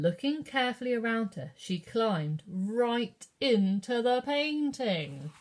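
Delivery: ordinary delivery, nothing marked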